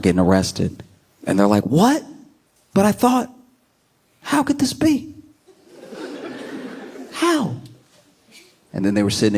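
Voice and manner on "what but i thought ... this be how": mock disbelief. Arrested rioter reacts to getting charged, in high mock disbelief. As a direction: play a stunned rioter who can't believe it.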